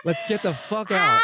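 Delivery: a laughing mock yell to flee; half-shouted through laughter. Joking about the burning paper city, laughing mock yell.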